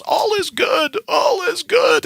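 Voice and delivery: hammed-up desperate shout; Kevin Bacon's panicked shout. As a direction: yell it like a frantic Animal House cadet being trampled. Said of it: "Kevin Bacon in Animal House".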